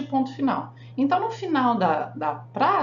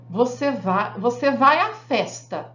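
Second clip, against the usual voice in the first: firm command on "Você vai à festa", "festa" landed hard; giving an order; 3 dB louder, slower than usual. Shown as a demonstration. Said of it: "Uma ordem".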